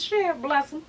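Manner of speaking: ordinary delivery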